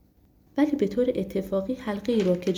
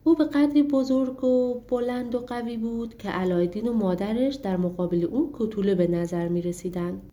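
ordinary delivery

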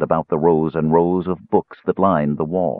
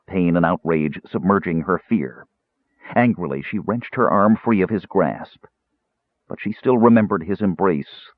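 usual speaking voice

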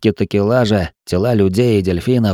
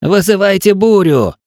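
shouting; 9 semitones higher than usual. A narrator's reading. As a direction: bellow it across the water. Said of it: "прокричал Тиль".

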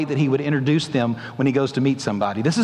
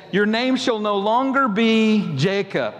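slow and higher, lightly voiced solemn God; 6 semitones higher, much slower than usual. Preacher voicing God renaming Jacob, slow and solemn. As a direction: proclaim the new name slowly. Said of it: "He said".

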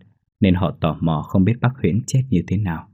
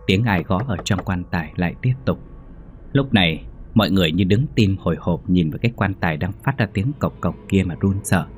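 ordinary delivery

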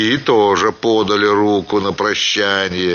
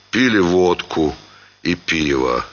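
ordinary delivery, nothing marked

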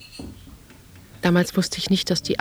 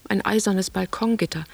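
ordinary delivery

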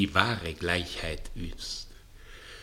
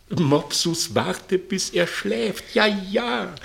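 much higher, slow and pressing; high urgent; 11 semitones higher, 6 dB louder, slower than usual. Acted. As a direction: hiss the order up high and slow. Plötzlich hohe, drängende Anweisung. (Herr drängt Mopsus hoch und eindringlich zu warten)

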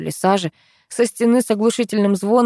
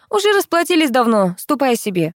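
higher, impatient brush-off; dismissive; 6 semitones higher than usual. A narrator's reading. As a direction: send the pest away. Narrator rebuffs the drunk. (отмахиваясь, с раздражением)

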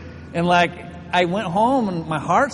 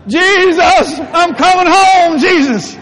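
high, very loud drawn-out wail; mocking dying cry; 12 semitones higher, 12 dB louder, much slower than usual. Jimmy teasing about the panic attack, hammed-up wail. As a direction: holler it in hammed-up agony. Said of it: "Jimmy's like".

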